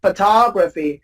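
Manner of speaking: photography as pho-TOG-ra-phy; stress on second syllable. Shown as a demonstration. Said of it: "stress on the ta".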